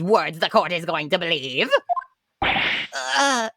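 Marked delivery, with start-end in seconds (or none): none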